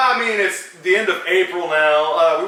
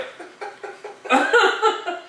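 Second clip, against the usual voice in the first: high wheezing laugh with no voice; real breathy wheeze of laughter; breathless silent laugh; 12 semitones higher than usual. Real, not acted. Laughing at own bit.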